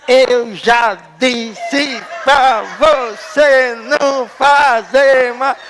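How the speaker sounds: each syllable of "Eu já disse pra você não fazer mais" hit slow and loud, one blow per syllable; mother scolding, one loud syllable per smack. Retold or imitated. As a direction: spank out every syllable. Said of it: "ela me ensinou separação silábica assim".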